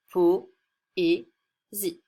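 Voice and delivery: "po", "et", "zi" said as three separate beats with pauses between; split into syllables. Shown as a demonstration. Teacher splitting a word for pupils, each syllable detached. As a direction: spell out poésie beat by beat: "po", "et", "zi". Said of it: "le mot poésie a trois syllabes".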